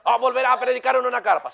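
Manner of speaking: a hammed-up believer voice; mocking the rumor. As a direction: parody the rumor with wonder. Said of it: "mocking false teaching".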